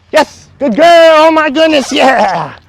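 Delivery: very high, loud, drawn-out praise and coaxing; high excited praise. Real, not acted. Trainer gushing and coaxing dog, very high and loud. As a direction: gush over the dog and urge her in. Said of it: "You're so animated".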